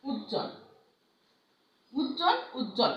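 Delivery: উজ্জ্বল said as "ujjol", no b sound after জ্জ; silent b-phala. Shown as a demonstration. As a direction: say উজ্জ্বল with a long double j and no b. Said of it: "যেমন দেখো".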